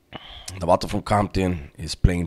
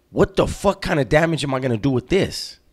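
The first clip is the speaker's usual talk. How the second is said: raised-pitch indignant complaint; pissed disbelief; 6 semitones higher than usual. Retold or imitated. Inmate complaining to the homie about a useless piece, pissed. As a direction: retell an exasperated rhetorical question. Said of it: "Night Owl was pissed".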